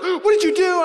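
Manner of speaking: high, loud alarmed mom; mom scolding. Retold or imitated. Mom confronting her son, high scolding. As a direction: demand it like a shocked mother. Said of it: "my mom's like".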